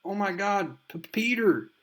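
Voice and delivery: high-pitched, stammering disbelief; panicked Harry Osborn. Acted. Son confronting his father in a comic recap, high and stunned. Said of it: "we see Harry Osborn's like".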